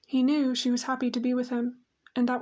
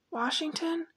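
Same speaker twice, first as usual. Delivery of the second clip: soft, higher, drowsy question; sleepy, blurred; 5 semitones higher, 4 dB quieter than usual. A narrator's reading. Mother not fully awake questioning her son, sleepy and blurred. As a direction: murmur it half asleep, syllables blurred. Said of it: "The syllables were blurred".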